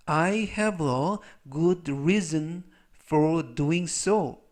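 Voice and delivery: very slow, word by word English; slow dictation reading. Shown as a demonstration. Teacher reads the example sentence for students, very slow. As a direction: read it out slowly so learners follow.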